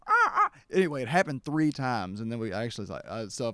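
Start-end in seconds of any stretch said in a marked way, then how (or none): 0.1-0.5 very high, loud cawing crow; crow caw imitation.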